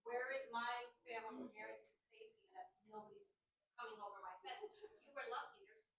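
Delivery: low, hushed, pressing; quiet and intense. Real, not acted. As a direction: drop the voice to a near whisper. Neighbor's fear for her family.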